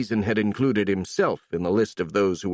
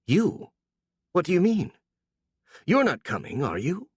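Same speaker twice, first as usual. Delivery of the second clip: surprised; 9 semitones higher than usual. A narrator's reading. Startled at unexpected news.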